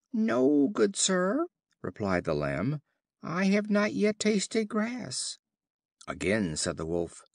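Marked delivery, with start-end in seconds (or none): 0.1-1.5 much higher, timid little lamb voice; a small lamb begging politely; the lamb denies the wolf's charge, pleading. A narrator's reading. Lamb answers the wolf. 3.2-5.4 high, slow, plaintive lamb voice; the lamb protests to the wolf, meekly; pleading lamb. A narrator's reading.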